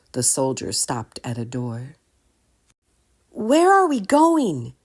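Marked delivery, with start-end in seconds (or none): 3.3-4.7 put-on high, loud, slow young voice; anxious boy pressing for answers; boy asking. A narrator's reading. Drake asking the soldier at the dungeon door. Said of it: "Drake finally asked".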